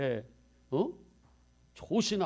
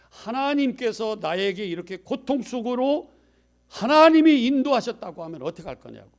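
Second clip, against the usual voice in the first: ordinary delivery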